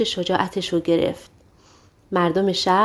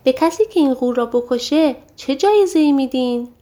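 eager question; 6 semitones higher, 3 dB louder than usual. A narrator's reading. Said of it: "جک پرسید".

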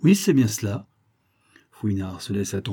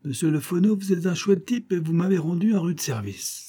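higher-pitched, lively urchin talk; cheeky high-spirited delivery; boyish exuberance; 7 semitones higher than usual. A narrator's reading. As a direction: gush thanks like an excited kid. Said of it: "sa nature de gamin reprenait le dessus".

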